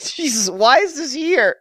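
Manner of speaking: high, laughing disbelief at the game; exasperated disbelief. Real, not acted. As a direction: throw up your hands at how silly it is. Exasperated rant, pitch jumps.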